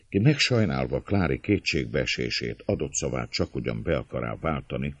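usual speaking voice

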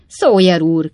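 calls out loud and irritated; irritated shout. A narrator's reading. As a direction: snap his name up to the roof. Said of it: "kiáltotta Pickwick úr ingerült hangon". (ingerült kiáltás)

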